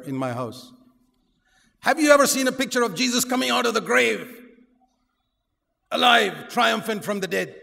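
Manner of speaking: loud rising preacher's cry, pressing the point; full-force preaching. Acted. Preacher rousing the congregation, loud and rising. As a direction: preach it hard, building up. Preaching against a helpless Jesus.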